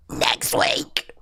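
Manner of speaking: breathy hushed reveal; whispered. Real, not acted. Streamer whispers the title news to chat.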